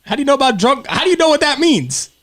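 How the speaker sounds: high, loud mock-shocked question; mock surprise. Real, not acted. Mother teasing daughter about drunk, mock shocked high. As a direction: act scandalized, voice jumping high.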